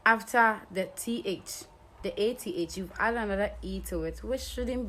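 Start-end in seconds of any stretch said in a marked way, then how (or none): none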